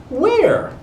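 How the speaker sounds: high eager "Where?"; eager bark. A narrator's reading. Dogs jumping at the mention of bones, high eager. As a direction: snap up at the word bones. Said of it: "barked the other dogs".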